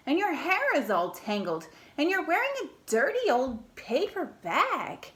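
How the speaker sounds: high, loud, disdainful prince; snooty prince. Acted. Prince scolding the messy princess, snooty. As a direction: look down your nose at her.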